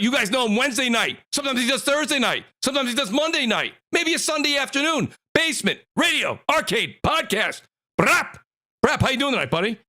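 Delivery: high, excited announcer build-up; hype host intro. Real, not acted. Host hyping up the stream's opening, excited high announcer voice. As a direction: pump up the crowd like a ring announcer. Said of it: "man, who's yelling?".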